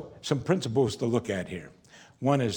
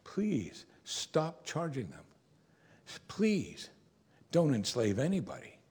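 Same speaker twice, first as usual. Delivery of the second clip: soft, imploring please, lifted high on each please; gentle pleading; 4 semitones higher, 4 dB quieter, slower than usual. Acted. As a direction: plead softly, not scolding. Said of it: "Then twice he says".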